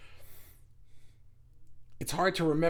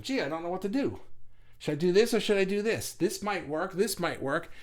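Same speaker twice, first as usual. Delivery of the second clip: lightly acted dithering, slightly raised pitch; mild indecision; 3 semitones higher than usual. Milder end of the inaction spectrum, playful dithering. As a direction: waver between the choices like a puzzled ditherer. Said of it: "It's a spectrum".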